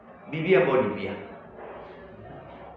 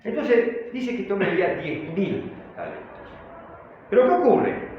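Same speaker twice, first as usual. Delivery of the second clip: normal talking voice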